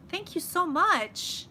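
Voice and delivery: gushing thanks. Real, not acted. Thanking a helpful viewer.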